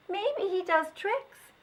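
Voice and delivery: put-on high, slow child voice; a kid hoping for a show; curious child. Child wonders about the dog.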